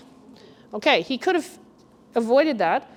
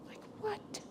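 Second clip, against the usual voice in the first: breathless whispered disbelief; barely-voiced amazed "what"; stunned whisper; 11 semitones higher, 22 dB quieter than usual. Real, not acted. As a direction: gasp it out, floored.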